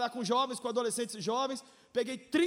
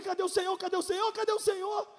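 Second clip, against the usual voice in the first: desperate plea to God; 7 semitones higher than usual. Retold or imitated. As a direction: beg God repeatedly, building. Repeated desperate question to God. (clamor desesperado a Deus, agudo e forte)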